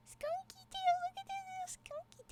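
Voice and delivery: put-on very high squealed baby talk; squealed cooing. Streamer gushing over a little skunky tail, high squeal. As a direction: coo it in a tiny, sky-high voice.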